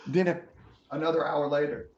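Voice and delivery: ordinary talk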